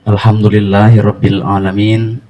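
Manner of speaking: measured, drawn-out Arabic invocation; prayer recitation. Real, not acted. Host opens a meditation gathering with prayer, measured recitation. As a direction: recite the opening formulas slowly and clearly.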